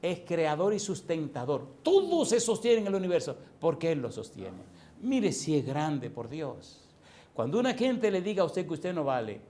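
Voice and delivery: loud, high challenge to the congregation; full-force preaching. Real, not acted. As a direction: fire rhetorical questions at the crowd.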